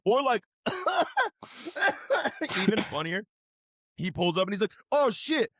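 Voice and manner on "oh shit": panicked cop. Retold or imitated. Acted cop reaction.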